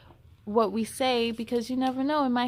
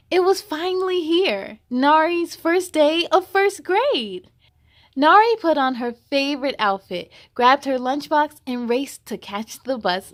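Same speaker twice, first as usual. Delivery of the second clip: lively, louder storybook voice; reading to children; 8 dB louder than usual. Acted. Host reading a picture book aloud to kids, lively. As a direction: read it brightly for young listeners.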